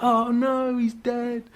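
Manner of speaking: high, drawn-out pretend alarm; mock dismay. Film viewer fearing the old guy is bitten, hammed-up dismay. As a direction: wail it in mock horror.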